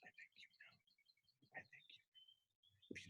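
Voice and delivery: barely audible whisper; conspiratorial whisper. Acted. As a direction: whisper a knowing hint. Whispered hint to students.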